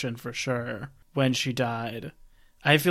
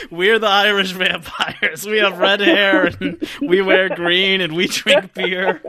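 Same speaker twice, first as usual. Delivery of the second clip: higher, slow, amused mimicry; mock vampire introduction; 4 semitones higher, 4 dB louder, slower than usual. Host mocking the Irish vampires to cohosts, amused mock-proud.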